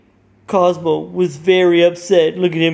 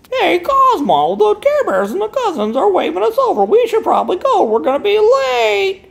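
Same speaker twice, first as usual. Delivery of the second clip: much higher cartoon fairy voice; cartoon Wanda. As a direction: hurry him along like a cartoon fairy. Wanda urging Cosmo to go.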